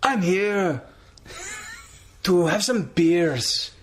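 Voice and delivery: high, drawn-out showy wrestler; Del Rio impression. Host as Del Rio arriving at the bar, hammed-up. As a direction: announce yourself grandly, slow and high. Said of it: "Do you wanna be A D R".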